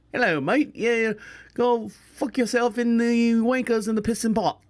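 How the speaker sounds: badly faked New Zealand accent, exaggerated. Uncle imitating his niece's husband from New Zealand, mock New Zealand accent. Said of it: "he's got a New Zealand accent".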